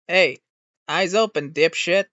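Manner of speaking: loud, irritated call to wake it up; angry yell. Real, not acted. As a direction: snap at the model to open its eyes. Yelling at unresponsive avatar model.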